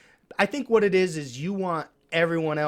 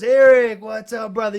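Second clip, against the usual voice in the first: high call, loud burst on the name; hailing greeting; 6 semitones higher, 10 dB louder than usual. Acted. Deli man hailing a regular at the door, high, loud greeting.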